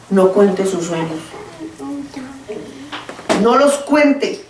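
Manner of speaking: each word of no cuente sus sueños laid down slowly; preacher retells her apology call, slow solemn warning. Retold or imitated. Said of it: "le dije".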